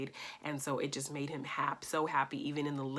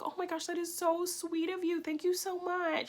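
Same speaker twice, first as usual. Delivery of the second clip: grateful thanks; 13 semitones higher than usual. Retold or imitated. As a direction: gush your thanks to the stranger. Woman thanks the speaker.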